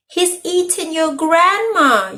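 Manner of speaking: high, slow alarmed yell. A narrator's reading. Rabbit yelling that grandma was eaten. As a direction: cry out the alarm. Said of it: "yelled Rabbit".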